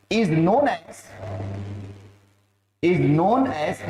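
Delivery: slow, separated dictated words; measured classroom dictation. Real, not acted. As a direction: dictate slowly for copying. Dictates next phrase for note-taking.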